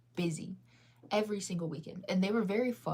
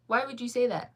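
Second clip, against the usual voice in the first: higher and louder, playfully offended; mock indignant; 5 semitones higher, 5 dB louder than usual. Real, not acted. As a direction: push back at the chat, half joking. Reacting to chat calling her sad.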